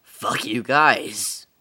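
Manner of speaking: slow, loud and drawn out. Shown as a demonstration. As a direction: stretch it out and hit it hard. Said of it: "same line in different ways".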